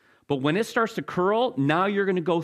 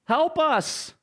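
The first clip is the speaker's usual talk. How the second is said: crying out; 10 semitones higher, much slower than usual. Acted. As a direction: cry out for rescue. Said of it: "as they cry out to him".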